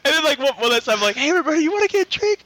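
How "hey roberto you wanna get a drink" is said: calling out again. Retold or imitated. Calling out one last time.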